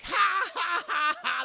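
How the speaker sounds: loud, high "ha ha ha ha"; hammed-up laughing at someone; mocking group laugh. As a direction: fake big derisive cackle. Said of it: "They all start laughing at him".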